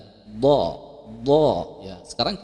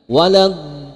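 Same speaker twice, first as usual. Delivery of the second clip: "Walad-" said louder with a heavy d; emphasis; 4 semitones higher, 8 dB louder than usual. Shown as a demonstration. Teacher repeating the emphasized syllable, louder. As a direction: press the d of "Walad-". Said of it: "ada penekanan".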